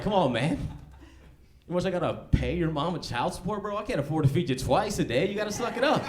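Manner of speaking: put-on a broke dad refusing to feed his kids; dismissive dad. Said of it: "And you're like".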